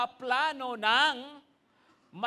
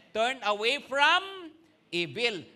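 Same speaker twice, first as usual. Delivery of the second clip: slow emphasis; 5 dB louder, slower than usual. Shown as a demonstration. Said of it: "slow emphatic repeat".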